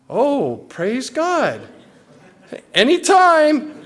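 slow, drawn-out insincere praise; fake pious reply. Pastor who forgot to pray answers the thanks, falsely gracious. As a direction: stretch it out to cover a fib.

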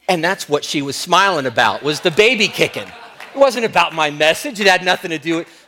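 full-voice pulpit emphasis; loud preaching punchline. Real, not acted. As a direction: land the humbling joke. Punchline on his vanity, loud.

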